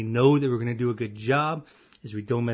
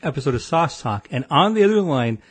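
announcer voice; 6 dB louder than usual. Acted. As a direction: boom it out like a game show host. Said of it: "Oh, that was fantastic".

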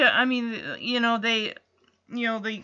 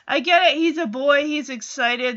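usual speaking voice

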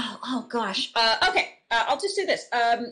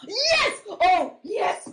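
high, excited shout of joy; 11 semitones higher, 5 dB louder, much slower than usual. Real, not acted. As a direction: cheer it out, thrilled. Excited about the segment.

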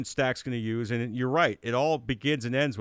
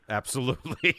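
laughing; 4 semitones higher, 5 dB louder than usual. Real, not acted. Laughing at the rival jab.

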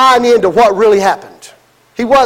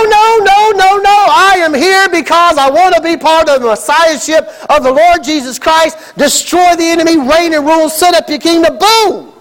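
shouted protest; 5 semitones higher than usual. Retold or imitated. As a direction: refuse it outright, like a zealot. Judas rejecting Jesus loudly.

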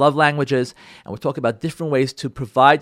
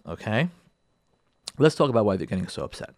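normal talking voice

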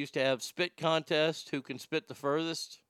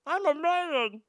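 put-on high-pitched childish squeak; squeaky kid; 13 semitones higher, slower than usual. [name] answering the neighborhood kids, high squeaky voice. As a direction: squeak it out like a dim happy kid.

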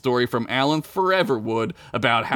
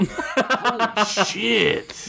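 laughing; 9 semitones higher, much slower than usual. Real, not acted. Laughing at own joke.

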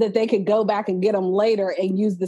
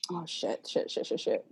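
breathy whispered shit, shit, shit; whispered cursing; 4 semitones lower, 12 dB quieter than usual. Real, not acted. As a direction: curse under your breath. Lost her question mid-show.

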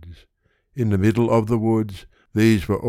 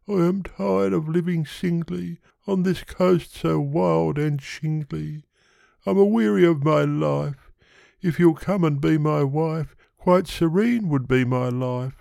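high-pitched pleading suitor; Yonghy Bonghy Bò; 9 semitones higher than usual. Acted. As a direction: propose in a high character voice.

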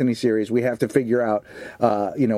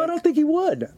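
mock high voice. Mocking [name] talk.

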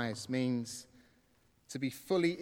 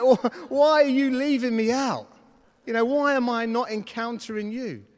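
high, loud complaint to God; cross; 7 semitones higher, 9 dB louder than usual. Retold or imitated. Man feeling left out demands answers from God, cross. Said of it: "I got cross".

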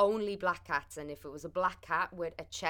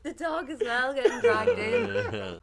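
laughing while talking, much higher; 8 dB louder, slower than usual. Real, not acted. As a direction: squeeze the words out through laughter. Laughing at own story.

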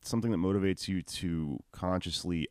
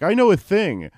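mock dumb man; 13 semitones higher, 10 dB louder than usual. Mocking the rule-following man.

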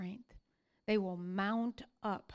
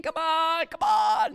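loud, higher desperate pleading yell; begging shout; 7 semitones higher, 10 dB louder than usual. Retold or imitated. False prophets begging their god, shouting. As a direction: cry out to a god who won't answer. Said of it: "They're screaming and yelling and begging".

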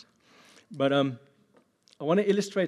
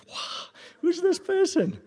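loud, high-pitched gasp of disbelief; exaggerated astonished outburst; mock shock; 7 dB louder, slower than usual. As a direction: react as if scandalized by a photo. Mock shock at Instagram photos.

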